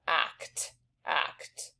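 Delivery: teacher whispering act to hear each sound; whispered act. Shown as a demonstration. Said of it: "we've got three sounds there".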